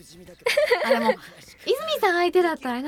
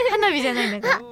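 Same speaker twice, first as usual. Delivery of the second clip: usual speaking voice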